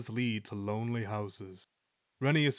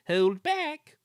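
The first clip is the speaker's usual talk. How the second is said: very high, squeaky stage whisper; conspiratorial squeal; conspiring whisper; 6 dB louder than usual. A narrator's reading. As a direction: pass it along in a high squeak. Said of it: "whispered Ruth to Jemima".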